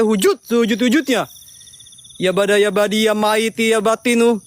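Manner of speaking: solemn ritual chant; summoning incantation. Real, not acted. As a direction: call out to the spirits. (mantra pemanggilan yang khidmat)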